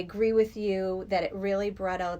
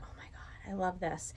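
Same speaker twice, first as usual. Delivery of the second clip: whispered thrill; 8 dB quieter than usual. Retold or imitated. Retold inner thrill.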